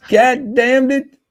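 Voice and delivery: loud, high, drawn-out mock outburst; mock cursing. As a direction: belt out a playful curse. Playful swearing after laughing at list.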